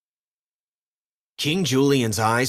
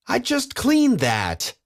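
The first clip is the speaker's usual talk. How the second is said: cried out; 13 semitones higher, slower than usual. A narrator's reading. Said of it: "he cried".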